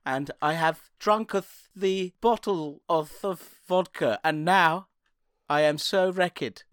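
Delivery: slow, hammed-up archaic speech; slowly drawled mock Shakespearean voice; mock olde English. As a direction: put on a faux-medieval drunk lament. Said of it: "an example, shall I".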